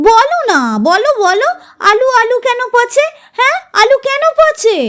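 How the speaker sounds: put-on high insistent child's demand. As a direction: raise the voice, pestering. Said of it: "Runa গলা চড়ালো". (মেয়ে মাকে চেঁচিয়ে জিজ্ঞেস করছে)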